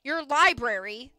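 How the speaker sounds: loud, drawn-out scornful repeat; sharp. A narrator's reading. Mill boss scorns the library idea, sharp. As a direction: throw the words back sharply, stretched out. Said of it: "the man said sharply".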